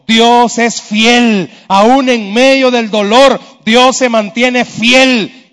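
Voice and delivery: loud, high-pitched impassioned preaching; preaching at full force. Real, not acted. Preacher assuring the congregation their trial is not forever, impassioned preaching. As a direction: drive each phrase home to the congregation.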